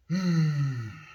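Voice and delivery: drawn-out exasperated sigh; long sigh. Real, not acted. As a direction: let out a long, falling sigh. Sigh after recalling anger.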